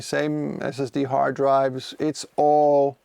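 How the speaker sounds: ordinary talk, nothing marked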